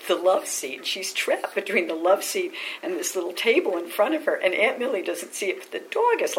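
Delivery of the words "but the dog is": plain reading voice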